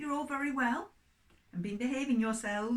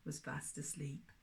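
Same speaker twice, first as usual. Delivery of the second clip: much softer, dropping away; fading to sleep; 9 semitones lower, 12 dB quieter than usual. Acted. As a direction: let the words drift off into sleep. Dog falls asleep, story ends.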